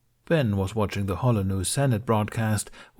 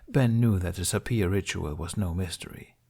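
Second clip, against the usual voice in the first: plain reading voice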